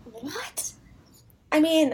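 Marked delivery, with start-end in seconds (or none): 0.0-0.7 breathless whispered what; whispered shock. Real, not acted. Hearing a friend never went there, whispered disbelief. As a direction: gasp it out in disbelief. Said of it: "I've never been".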